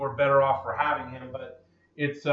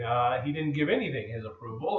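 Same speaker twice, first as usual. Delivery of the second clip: ordinary delivery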